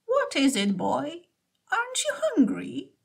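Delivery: put-on old woman's voice. A narrator's reading. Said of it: "asked the old woman".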